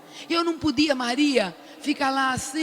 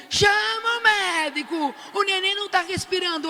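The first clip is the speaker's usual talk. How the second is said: desperate shout; 6 semitones higher than usual. Retold or imitated. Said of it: "E nenhum chegava".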